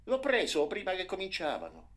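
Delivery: high, loud eager reply; excited answer. A narrator's reading. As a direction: blurt it out proudly. Frightened brother answering.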